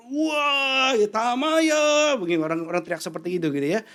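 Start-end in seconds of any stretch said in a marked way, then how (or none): 0.1-2.2 high, loud Tamaya call; mock festival cheer; crowd cheer. Crowd shouting at fireworks.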